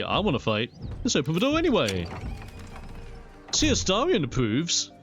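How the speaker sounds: ordinary talk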